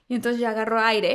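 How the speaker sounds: ordinary talk, nothing marked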